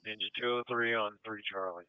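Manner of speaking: ambulance radio. Real, not acted. Dispatch radio traffic.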